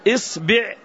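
إصبع said "is-bi'", i in both syllables; short i after hamza and ba in إصبع; kasra on both. Shown as a demonstration. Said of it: "نكسر الهمزة فنقول".

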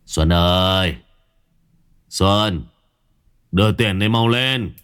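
lightly voiced drunk father slurring demands; a hint of a drunken husband calling out; drunk mumbling husband. A narrator's reading. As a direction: call for the wife like a tipsy grumbling man. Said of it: "tiếng lèm bèm quen thuộc của ông Khương".

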